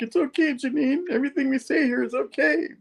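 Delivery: high-pitched imitation of concerned reassurance; Ben's concerned voice. Ben reassuring Janine in counseling, high mock-concerned voice. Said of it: "Ben's all looking concerned".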